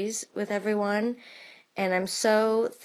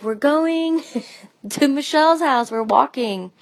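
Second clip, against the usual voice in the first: laughing; 6 semitones higher, 4 dB louder than usual. Retold or imitated. Lauren answers parents, laughing.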